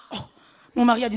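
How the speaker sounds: normal talking voice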